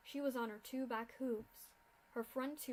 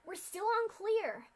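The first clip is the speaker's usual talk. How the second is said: high, loud shout; 9 semitones higher, 8 dB louder than usual. Acted. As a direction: yell over the chaos. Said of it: "she shouted".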